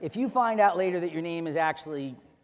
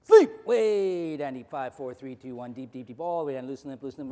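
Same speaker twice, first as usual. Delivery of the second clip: hypnotic induction; 4 semitones lower, 5 dB louder than usual. Acted. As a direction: sink them all the way down.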